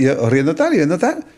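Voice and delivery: normal talking voice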